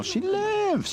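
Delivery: very high cry of disbelief; stunned joy. Acted. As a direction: gasp it out, unable to believe. Goldie can hardly believe it.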